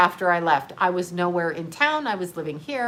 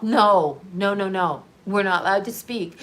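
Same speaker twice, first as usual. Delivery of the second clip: usual speaking voice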